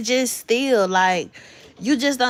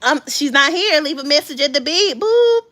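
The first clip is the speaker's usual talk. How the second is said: light mock answering machine, high final "Boop."; playful voicemail voice; 7 semitones higher, 6 dB louder than usual. Mom faking a voicemail greeting for her little son. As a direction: pretend to be her own voicemail to tease her son. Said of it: "That's how I have to get him".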